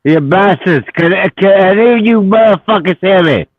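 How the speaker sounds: loud, rough pirate-style shouting. Real, not acted. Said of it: "You sound like a pirate".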